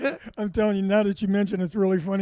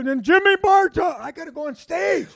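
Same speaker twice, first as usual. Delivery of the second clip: loud, high, drawn-out stage call; acted shouting; 8 semitones higher, 7 dB louder, slower than usual. Nervous comic hearing his introduction, acted high slow shout. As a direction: imitate being hollered onto stage in a panic.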